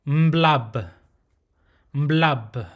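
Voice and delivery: mblab with a hummed m onset and a closing b; closed mblab. Shown as a demonstration. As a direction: hum then close mblab on the lips. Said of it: "this is how it's pronounced".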